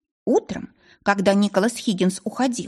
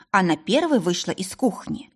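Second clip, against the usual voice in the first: ordinary delivery, nothing marked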